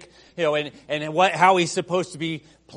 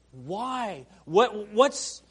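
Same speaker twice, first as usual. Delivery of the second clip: normal talking voice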